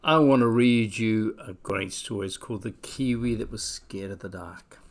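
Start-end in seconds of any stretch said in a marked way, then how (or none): none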